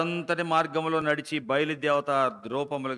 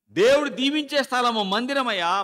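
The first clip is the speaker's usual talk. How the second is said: fervent preaching; 11 semitones higher, 8 dB louder than usual. Acted. Preacher proclaims.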